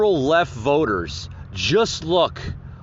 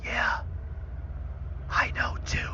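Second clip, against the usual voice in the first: mock whisper; 8 semitones higher, 10 dB quieter than usual. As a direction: mimic a creepy whispered aside. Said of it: "He's whispering in the freaking mic".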